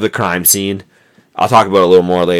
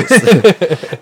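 short amused laugh; 11 semitones higher than usual. Real, not acted. Laughs at own segment name.

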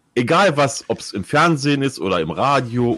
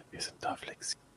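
whisper; 21 dB quieter, faster than usual. Pretend. Mock whisper after whisper talk.

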